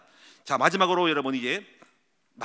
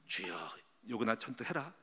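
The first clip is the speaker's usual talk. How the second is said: murmured low and soft under the breath; silent inner prayer; 7 semitones lower, 11 dB quieter than usual. Retold or imitated. Pastor retelling his silent prayer during a fight with his wife, hushed murmur. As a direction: pray it inwardly so nobody hears. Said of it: "속으로 막 결박을 했어".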